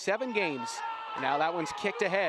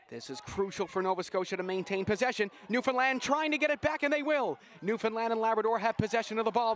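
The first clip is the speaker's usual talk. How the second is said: building excited play-by-play; 6 semitones higher than usual. Acted. Commentator calling the final push, urgent and building. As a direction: drive the call as the clock runs down.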